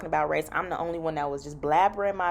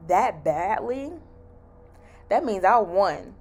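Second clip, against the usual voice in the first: ordinary talk, nothing marked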